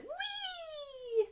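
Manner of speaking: a very high gleeful squeal; squealing queen. Acted. Queen squeals at the seal's horn, very high and gleeful. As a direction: squeal with delight like a silly queen. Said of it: "and the queen said".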